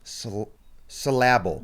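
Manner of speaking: slow, halting "syllable" as suh-LAB-ul; careful wrong stress. Shown as a demonstration. Podcaster copies Kate's word, slowly putting the stress on the middle of "syllable".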